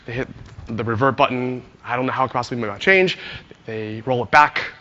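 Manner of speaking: normal talking voice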